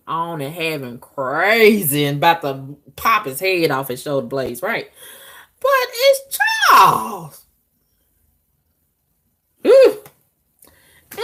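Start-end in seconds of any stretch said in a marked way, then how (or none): 5.6-10.0 high, loud wailing whine, stretched slow; mock whining cry. Groaning to listeners that it's just Charles, hammed-up wail. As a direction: wail it like a crybaby who can't bring herself to do it.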